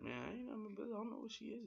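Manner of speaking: squeaky innocent protest; Cass denying. Retold or imitated. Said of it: "he's like".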